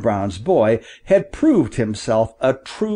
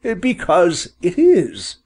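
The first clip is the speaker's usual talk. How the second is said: lightly voiced father quail, higher and drawn out; slow, higher storybook reply; father bird, replying; 7 semitones higher, slower than usual. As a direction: answer slowly, a little bird-like and high.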